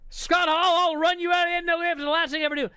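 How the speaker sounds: high, shouted wrestler threats; Jeff Jarrett ranting. Retold or imitated. Wrestler challenging everybody and threatening Scott Hall, high shouted rant. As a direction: yell the challenge, rushing at the end. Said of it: "Jarrett's still ranting".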